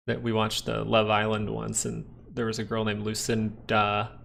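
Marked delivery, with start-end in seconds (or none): none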